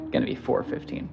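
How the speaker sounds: hushed, secretive whisper. Pretend. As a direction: breathe the price like a secret. Secret-sounding price, unvoiced.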